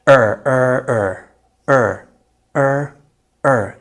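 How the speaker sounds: er er er, the vowel held; drawn er sound. Shown as a demonstration. Teacher drilling the er sound for learners. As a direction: repeat the er sound slowly. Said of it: "Do it exactly the way I said it".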